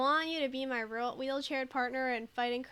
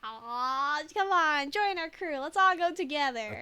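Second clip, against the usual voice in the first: higher, louder, coaxing plea; playful pleading; 3 semitones higher, 7 dB louder than usual. Pretend. Streamer begs a game character to join the crew, playful pleading. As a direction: coax them eagerly, playfully pushing.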